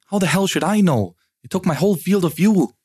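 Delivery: loud, high angry yell at him; yelling back. Acted. Wife yelling back at her pushy husband in anger. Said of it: "she yelled back at him".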